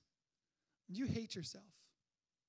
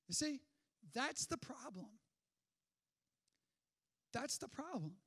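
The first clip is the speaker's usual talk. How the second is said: usual speaking voice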